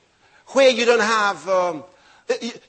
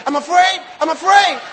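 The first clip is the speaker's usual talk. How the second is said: mocking repetition; 4 semitones higher, 3 dB louder than usual. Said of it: "I can't say that".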